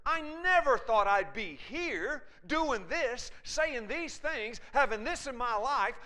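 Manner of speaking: high, loud cry of self-reproach; anguished bewilderment. Acted. Acted anguish of one who strayed.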